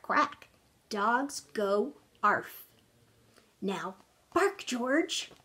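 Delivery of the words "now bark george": ordinary delivery, nothing marked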